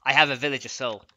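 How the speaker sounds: normal talking voice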